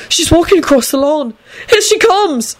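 high, panicky warning; terrified. A narrator's reading. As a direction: squeak the alarm in terror. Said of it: "whispered in a terrified voice".

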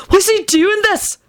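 high, drawn-out wild question; wild, high-pitched alarm. A narrator's reading. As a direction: ask it wildly, pitched right up. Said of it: "Benji said wildly to Sirius".

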